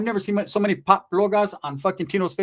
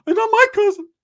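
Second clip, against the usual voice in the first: high-pitched screaming character, very loud; Ricky's outraged shriek; 7 dB louder than usual. Acted. Ricky rejects the cousin.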